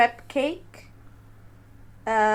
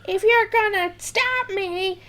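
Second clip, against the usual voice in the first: rising to a high, shrieking yell; Ruth from Ozark; 13 semitones higher, 3 dB louder, slower than usual. Retold or imitated. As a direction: quote her climbing into a scream. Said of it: "the scene where she says".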